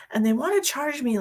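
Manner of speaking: usual speaking voice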